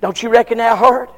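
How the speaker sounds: ordinary talk, nothing marked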